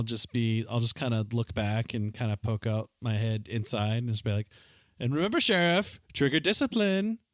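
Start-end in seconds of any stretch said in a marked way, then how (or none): 5.0-7.2 higher-pitched parting reminder; parting admonition. Acted. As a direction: call a pointed reminder back from the doorway. Parting line acted to sheriff.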